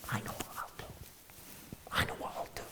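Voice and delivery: hushed, plotting to himself; scheming whisper. Retold or imitated. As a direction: whisper it like a secret plan. Scheming inner thought, whispered.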